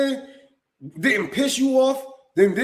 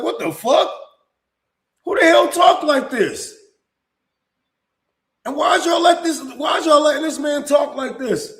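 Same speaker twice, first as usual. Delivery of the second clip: higher, louder disbelieving shout; outraged shout; 5 semitones higher, 4 dB louder than usual. Retold or imitated. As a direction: yell it in shocked outrage. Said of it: "I'm like".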